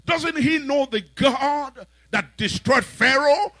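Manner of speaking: high, loud outraged questions; indignant shout. Acted. David protesting to the king, loud and high. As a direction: demand it, stunned at their fear.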